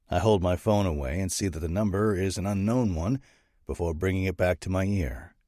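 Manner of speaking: plain reading voice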